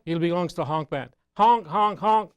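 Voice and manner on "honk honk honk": silly clown honking. Acted. Goose honking in a silly joke.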